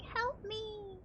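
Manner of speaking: high, quiet, feeble help me; a tiny whimpered cry from the machine. Pretend. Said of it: "My computer's like".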